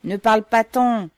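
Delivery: fed-up guard yelling; irritated shout. A narrator's reading. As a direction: cut her off loudly, annoyed. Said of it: "cria le gardien avec irritation".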